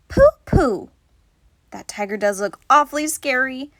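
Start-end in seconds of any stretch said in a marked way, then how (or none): 0.1-0.9 scoffing "Pooh, pooh", louder; dismissive pooh. Acted. Madeline scoffing at the tiger in the zoo. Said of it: "Madeline just said".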